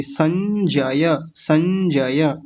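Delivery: संजय very slow, nasal bindu sound, full य; correct Sanskrit संजय. Shown as a demonstration. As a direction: draw out संजय with a clear final य. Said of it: "बिल्कुल वैसे ही आएगी".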